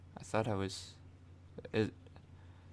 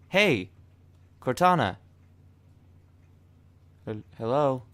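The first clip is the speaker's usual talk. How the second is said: calling out; 4 semitones higher, 5 dB louder than usual. Real, not acted. Calling out to voice assistant.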